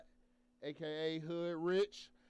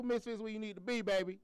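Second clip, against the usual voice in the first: ordinary talk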